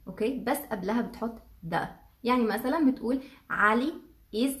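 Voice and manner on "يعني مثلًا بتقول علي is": ordinary talk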